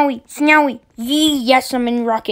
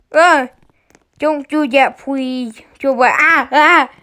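usual speaking voice

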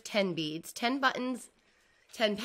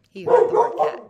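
amused exclamation; 12 semitones higher, 13 dB louder than usual. Real, not acted. Aside about the pet reacting.

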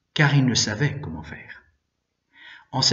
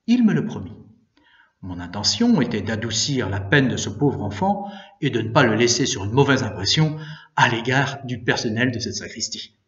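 plain reading voice

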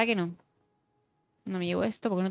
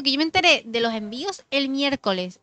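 ordinary talk, nothing marked

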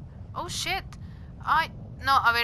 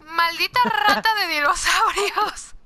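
mock outrage; 7 semitones higher, 5 dB louder than usual. Real, not acted.

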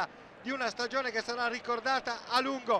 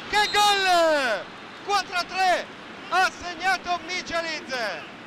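higher, louder cry of che gol; excited goal call; 4 semitones higher, 4 dB louder, slower than usual. Real, not acted. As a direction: burst out at the great goal.

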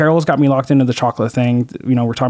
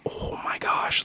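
hushed horrified whisper; whispered to wife; 13 dB quieter, much slower than usual. Retold or imitated. Turning to his wife after the gaffe, whispered. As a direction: breathe it without voice.

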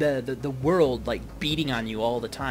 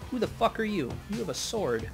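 surprised; 7 semitones higher than usual. Real, not acted. Surprised by enemy.

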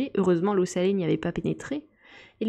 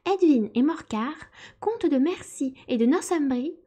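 high, pompous lecturing voice; self-important mouse; 7 semitones higher than usual. A narrator's reading. Mouse lectures the animals, high and pompous. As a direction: recite dry history like a proud little professor. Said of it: "reprit la souris d'un air important".

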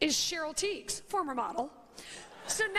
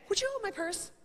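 casual ask; 5 semitones higher than usual. Pretend. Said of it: "I will just casually say".

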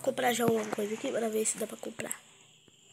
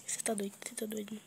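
whispering; 10 dB quieter than usual. Real, not acted. Hiding, whispering to self.

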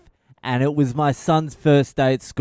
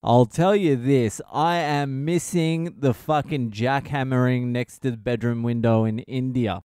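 usual speaking voice

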